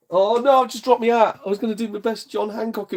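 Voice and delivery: high, loud startled cry; real surprise. Real, not acted. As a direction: caught off guard as the hat falls. Drops hat on camera.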